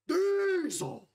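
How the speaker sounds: hammed-up long shout; bellowed word. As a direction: belt the word like a rallying cry. Dramatic fuel rant.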